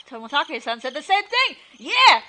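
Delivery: loud excited burst; sudden realization. Acted. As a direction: it clicks, shout it.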